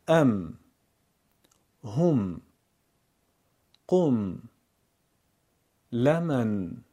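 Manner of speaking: ام، هم، قم slowly, one word at a time with long pauses; Quranic word drill. Shown as a demonstration. Teacher practises Quran words with learners: ام، هم، قم slow and separated. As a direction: recite each word like لمن and لم تمت deliberately, holding the final sakin letter. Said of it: "چند کلمهٔ قرآنی و تمرین حرف ساکن".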